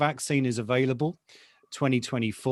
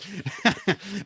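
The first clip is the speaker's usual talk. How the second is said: short laugh; 9 semitones higher than usual. Real, not acted. Laughs at pessimistic poll result.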